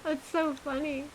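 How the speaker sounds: high, wavering, close to tears with laughter; teary laughter. Real, not acted. Streamer still wiping tears of laughter, voice high. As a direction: squeak it out through tears of laughter.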